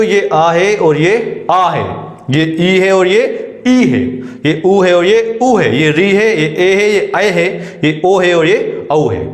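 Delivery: slow pointing out. Shown as a demonstration. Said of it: "ये क्या है बच्चों".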